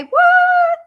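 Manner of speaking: hammed-up incredulous squeak; squeaky disbelief. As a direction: squeal it like you can't believe it. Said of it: "That's what I'd say".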